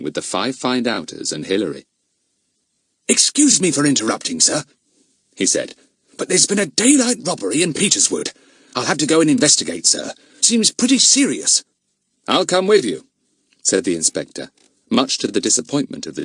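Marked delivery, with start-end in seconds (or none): 3.1-4.6 a hint of a respectful constable; higher, polite report to a superior; policeman voice. 6.2-11.6 higher, quick constable report; urgent constable. Acted. Policeman reports a robbery. 12.3-13.0 a hint of a brisk inspector; higher, decisive. Inspector's reply.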